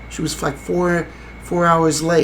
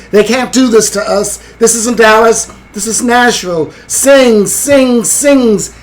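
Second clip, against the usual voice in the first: raised, higher voice, quoting a man's yell; imitated yell; 7 semitones higher, 7 dB louder, slower than usual. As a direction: call it out louder, urging each word like a plea to a crowd. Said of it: "and he yells".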